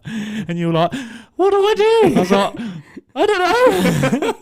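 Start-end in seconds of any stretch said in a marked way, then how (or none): 1.3-2.2 very high, louder panicked squeal; high mock panicked question. Retold panicked friend. 3.2-4.3 very high, slow giggling reply; helpless, laughing; high slow laughing mock reply; laughing helplessly.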